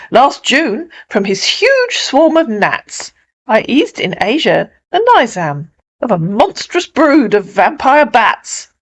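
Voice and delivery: lightly voiced piper with animated rises; a hint of a proud showman; boasting piper. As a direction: recount past feats with theatrical relish.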